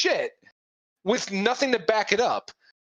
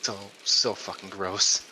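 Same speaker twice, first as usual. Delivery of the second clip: low disgusted mutter; 12 semitones lower, 5 dB quieter than usual. Pretend. Voicing moderator's disgust.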